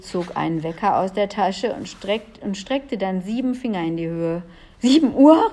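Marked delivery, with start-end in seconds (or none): 4.8-5.5 high, loud startled question; alarmed cry. A narrator's reading. Julia sees how late it is, crying out alarmed. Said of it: "rief Julia erschrocken".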